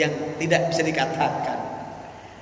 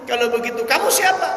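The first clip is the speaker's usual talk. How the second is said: bewildered question; 6 semitones higher than usual. Acted. Beggar asks who he is.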